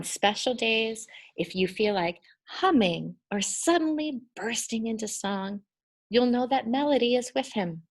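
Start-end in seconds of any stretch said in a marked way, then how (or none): none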